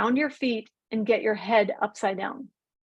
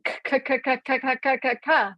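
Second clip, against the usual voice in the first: repeated sharp "k, k, k"; hard k sounds; 2 semitones higher, faster than usual. Shown as a demonstration. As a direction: tap out the hard k like racing thoughts. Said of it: "it starts with the hard C".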